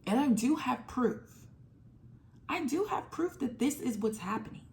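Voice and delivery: ordinary delivery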